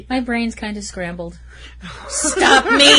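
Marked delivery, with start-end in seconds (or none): 2.1-3.0 loud cry through laughter; laughing mock plea. Punster begging co-host to stop her, laughing mock plea. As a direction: beg playfully while cracking up.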